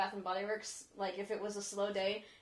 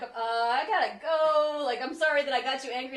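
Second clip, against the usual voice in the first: nervous apology; 5 semitones higher, 10 dB louder than usual. Retold or imitated. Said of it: "certain accents from the East Coast".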